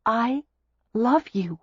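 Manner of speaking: I and love pressed high and firm; emphatic "I love you" with weight on "love"; insisted. A narrator's reading. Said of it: "Daisy insisted".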